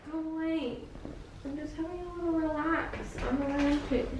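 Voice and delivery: high, soft, cutesy protest; acting cute. Real, not acted. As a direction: plead softly and high, playing cute. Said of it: "trying to act real cute".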